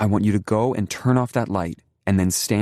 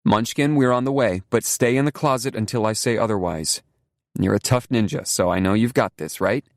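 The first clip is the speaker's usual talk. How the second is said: encouraging; 6 semitones higher, 3 dB louder than usual. A narrator's reading. Mother encourages child.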